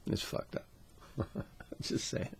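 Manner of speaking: unvoiced whisper with a laugh; whispered aside. Real, not acted. Vlogger muttering his verdict to viewers, whispered. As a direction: whisper it, half amused.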